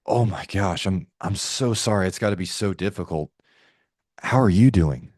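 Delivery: low, gentle sympathy; heartfelt, subdued condolence; concerned tone. Shown as a demonstration. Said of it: "how to use a concern tone".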